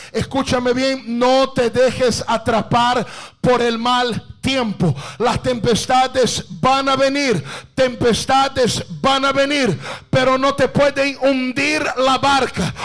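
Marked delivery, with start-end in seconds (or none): none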